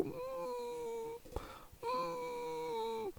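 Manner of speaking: high, thin dog whimper, very soft. Retold or imitated. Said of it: "It's where the people go".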